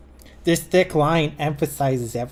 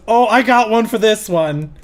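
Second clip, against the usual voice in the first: louder, higher, sassy volunteer; eager inner voice; 6 semitones higher, 6 dB louder than usual. Acted. One of many voices in the head, eagerly butting in. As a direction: butt in like a voice claiming the joke. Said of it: "and one goes".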